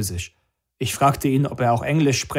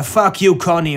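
higher, louder burst of anger; angry outburst; 7 semitones higher, 7 dB louder than usual. A narrator's reading. Eugene cursing the concert promoter on the phone, angry outburst. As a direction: curse the caller out, voice raised. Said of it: "redete laut und erregt auf den Anrufer ein".

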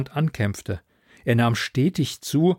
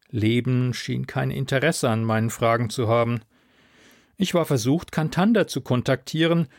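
ordinary delivery

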